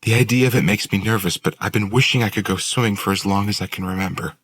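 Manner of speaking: put-on wistful, nervous girl's voice; Willow. A narrator's reading. Willow explains, held character voice.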